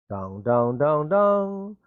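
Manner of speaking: sing-song "dong" stepping up in pitch; imitated announcement chime. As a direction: mimic a PA chime, rising note by note.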